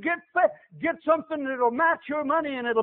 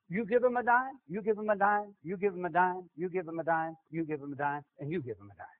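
each repeat softer and lower until it fades out; fading repetition; 7 semitones lower, 8 dB quieter than usual. Shown as a demonstration. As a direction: let the phrase die away to nothing. Repeats phrase fading away.

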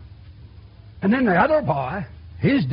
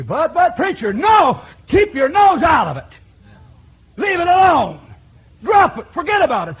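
shouted, higher objection to the preacher; loud protest; 8 semitones higher, 7 dB louder than usual. Acted. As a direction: push the preacher away at full volume.

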